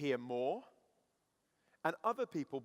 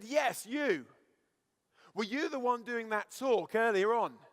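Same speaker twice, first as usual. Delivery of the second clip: loud, raised-pitch confrontation; shouted challenge; 6 semitones higher, 5 dB louder, slower than usual. Retold or imitated. Guy confronting the speaker about the lunchtime talk, shouted challenge. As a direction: call someone out, pressing for an answer. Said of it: "And so he shouted again".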